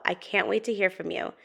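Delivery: usual speaking voice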